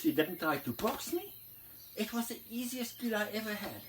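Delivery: a touch of a proud lioness bragging to her mate; mother lion's voice. Retold or imitated. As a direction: lioness boasting to daddy lion, lightly voiced. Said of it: "Mommy says".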